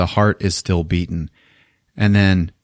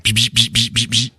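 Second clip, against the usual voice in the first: short crackling bzz bursts; zappy mouth-made sparks; imitated electrical buzzing. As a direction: mimic sparking live cables. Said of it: "swinging around and, like".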